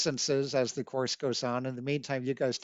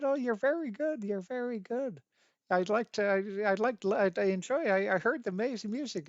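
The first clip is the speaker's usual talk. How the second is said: retold gushing compliment, higher pitched; admiring praise; 8 semitones higher than usual. Visitor compliments Grandma's piano playing, admiring.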